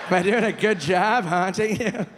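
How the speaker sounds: normal talking voice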